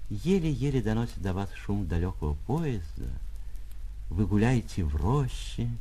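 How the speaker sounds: ordinary delivery, nothing marked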